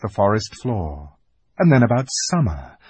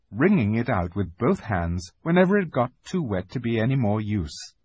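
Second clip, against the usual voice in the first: usual speaking voice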